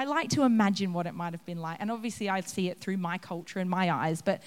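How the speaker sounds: usual speaking voice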